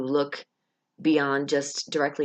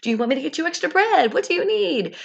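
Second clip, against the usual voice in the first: much higher, quick, bubbly waitress voice; eager server; 11 semitones higher than usual. Retold or imitated. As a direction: chirp it at the table like an eager waitress. Acting herself serving tables.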